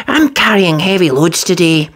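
busy engine puffing it out. Acted. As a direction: say it short of breath. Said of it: "Thomas puffed".

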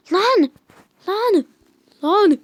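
sharp angry yells; frustrated shouting. Real, not acted. Game frustration outbursts.

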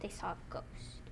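hushed spooky whisper. Acted. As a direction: breathe it out in a whisper. Telling a spooky ghost story.

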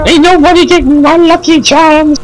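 put-on high squeaky leprechaun Irish accent; bad Irish accent. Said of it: "worst Irish accent".

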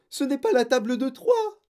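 high, loud, dismayed objection; squeaked protest; protesting cry. A narrator's reading. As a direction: cry out like a panicked pupil. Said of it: "s'écria Guillaume".

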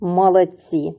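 ordinary talk

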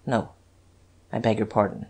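low, quiet stammered apology; softly faltering; subdued, flustered apology. A narrator's reading. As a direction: apologise low and shaken, with small pauses. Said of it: "he stammered".

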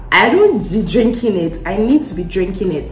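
usual speaking voice

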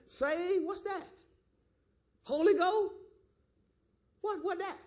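high-pitched mock bewilderment; puzzled, rising little questions; powerless, clueless questioning. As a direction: play dumb, as if the words are brand new. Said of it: "if you ain't got no power, you be like".

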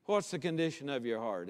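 ordinary talk

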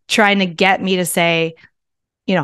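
normal talking voice

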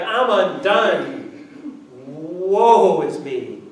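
drawn-out, very slow lament; despairing Isaiah. Acted. Isaiah before God's holiness, very slow despair. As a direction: let each word sink in hopeless dread. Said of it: "Maybe a moment before him he's like".

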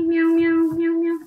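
high, even little meows; cat meowing. Pretend. Player asking a cat to team, cat meowing. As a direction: mew steadily on a high note.

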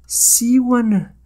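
high-pitched, obedient reply; submissive witch. Acted. Hypnotized witch answers the hero, submissive.

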